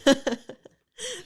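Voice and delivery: high laughter breaking words; laughing. Real, not acted. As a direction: laugh mid-sentence. Laughing at card combo.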